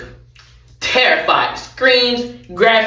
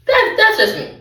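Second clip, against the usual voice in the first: "That, that's just me" said much higher; higher-pitched aside; 13 semitones higher than usual. Real, not acted. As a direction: lift "that's just me" up high, playful. Repeated aside, raised.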